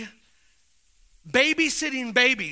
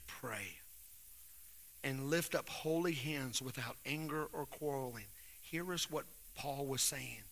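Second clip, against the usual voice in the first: much lower and softer, intimate; soft confiding talk; 10 semitones lower, 13 dB quieter than usual. Real, not acted. Pastor confiding with ashamed men, soft and low. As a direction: lean in and speak quietly.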